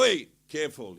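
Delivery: sharp loud warning; warning shout. Retold or imitated. Warning outsiders grabbing women, loud sharp call.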